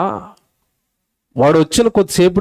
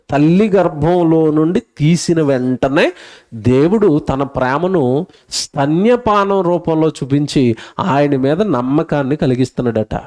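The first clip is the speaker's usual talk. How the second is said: ordinary talk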